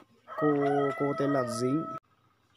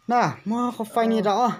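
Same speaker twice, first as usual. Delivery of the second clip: loud and high, nearly shouting; to someone hard of hearing; 9 semitones higher, 8 dB louder than usual. Real, not acted. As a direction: speak up for her deaf ears. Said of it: "phải nói to to bà cụ mới nghe được".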